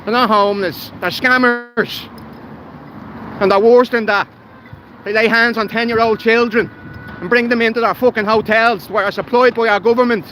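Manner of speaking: worked up and hammering the point; real anger boiling over; angry rant. Real, not acted. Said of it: "sorry about that again".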